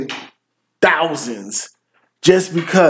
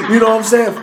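laughing; 7 semitones higher, faster than usual. Real, not acted. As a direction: break up laughing as you talk. Laughs at own retold outburst.

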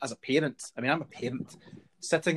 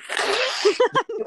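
squeaky high imitated slurping noise; imitating a show-off slurp at the cinema; mock slurp; 8 dB louder than usual. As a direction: send up someone noisily slurping a drink. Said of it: "they always go".

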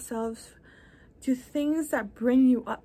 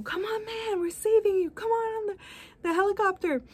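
much higher, coaxing rescuer voice; helicopter rescuer; 9 semitones higher, faster than usual. Retold or imitated. Helicopter crew calling the man up, high and coaxing. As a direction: coax him up the rope, pitched high. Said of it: "and they go".

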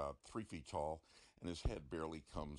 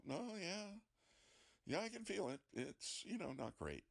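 much higher, as if tensed up; strained discomfort; 14 semitones higher than usual. Acted. Patient telling the doctor he can feel it, strained. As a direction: squeeze it out high, putting up with the needle. Patient feeling the needle during procedure.

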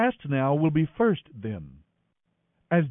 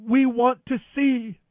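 pleading; 8 semitones higher, 4 dB louder than usual. A narrator's reading. Blind men plead to Jesus.

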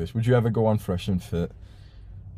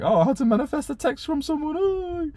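much higher mocking girly voice; mocking little girls; 3 dB louder than usual. Mocking girls in manifestation videos, high girly parody. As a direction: parody a silly teen girl, pitched way up. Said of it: "little girls just sat there like".

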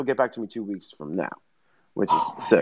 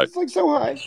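much higher, nervous Morty squeak. Retold or imitated. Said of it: "Morty's hard for me".

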